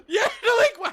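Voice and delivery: laughing in disbelief. Real, not acted. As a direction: burst out laughing at it. Laughs at the clip.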